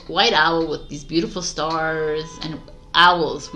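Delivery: usual speaking voice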